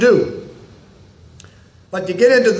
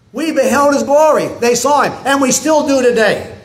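higher and louder, building preacher's fervor; rising preaching fervor; 6 semitones higher, 3 dB louder than usual. Real, not acted. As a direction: lift the glory line to the rafters. Sermon climbing to its peak.

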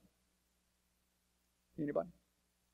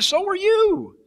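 high, loud, laughing retort; to the governor, high and laughing; laughing comeback; 8 dB louder, much slower than usual. Retold or imitated. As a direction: toss it back with a laugh.

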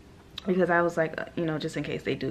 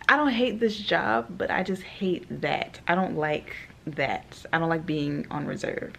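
usual speaking voice

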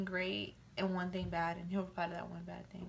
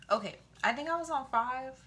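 ordinary talk, nothing marked

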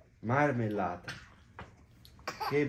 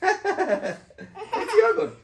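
much higher, very slow, laughing through it; laughing reveal; 5 dB louder, much slower than usual. Real, not acted. Father laughing at his joke for the kids. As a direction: laugh as you give the punchline.

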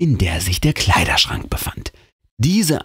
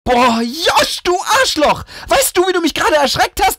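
much higher, angry yell; yelling at him. Acted. She yells at her brother for scaring her, angry yell. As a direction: tell him off at full pitch. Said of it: "brülle ich ihn an".

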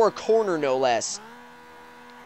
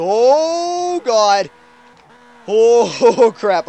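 loud, high startled cry; 4 semitones higher, 7 dB louder, much slower than usual. Real, not acted. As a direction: caught off guard, loud. Nearly hits wall.

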